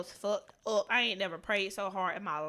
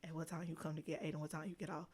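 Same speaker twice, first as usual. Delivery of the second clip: much softer, low quick caller voice; caller on the phone; 5 semitones lower, 12 dB quieter than usual. Retold or imitated. Aunt phoning about picking up Aiden, low and quick. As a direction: murmur it like a voice down the line. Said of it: "then I get a phone call".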